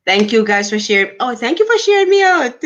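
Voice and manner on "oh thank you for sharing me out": much higher, fast, bubbly thanks; delighted thanks. Real, not acted. Host thanking a viewer for sharing, gleeful and high. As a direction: gush back at the viewer happily.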